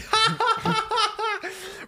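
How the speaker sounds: very high, cackling laugh; mocking laughter. Kids making fun of Ben's pet name, derisive cackle. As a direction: cackle at his expense.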